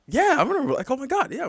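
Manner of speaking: high excited yeah, I remember; surprised. Retold or imitated. Recalling Lamar with cousin, high surprised reply. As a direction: pitch jumps way up in surprised recognition. Retelling surprised reaction, much higher.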